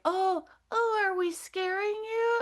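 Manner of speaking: high, gentle female alien voice. Retold or imitated. Female alien asking whether she scared the teller, high feminine voice. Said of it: "her voice seemed more female".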